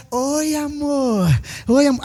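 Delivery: slow, lightly voiced sly come-on; a hint of a smooth seducer; flirty coworker. Pastor mimics a coworker sweet-talking a married woman, slow and sly. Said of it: "vem ele por trás".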